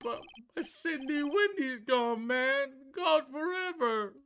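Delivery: slow, high, whiny grief; heartbroken wail. Acted. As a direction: wail over the lost love. Larry mourns lost girlfriend.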